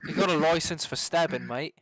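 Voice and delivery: put-on jokey UK accent; British accent. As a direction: play a British street cop, hammed up. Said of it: "it's the U K".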